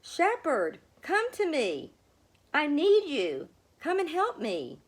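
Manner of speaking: high, loud call for help; a desperate cry to be heard; calling loudly. A narrator's reading. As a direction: call out to the shepherd across the distance. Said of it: "She lifted her voice and called loudly".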